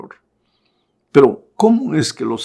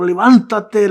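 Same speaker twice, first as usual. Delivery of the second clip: levántate called out higher and louder; rousing command; 8 semitones higher, 5 dB louder, slower than usual. Acted. Paul commanding the reader to stand, higher and louder. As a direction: shout levántate as a rallying call. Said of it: "lo que Pablo te dice hoy".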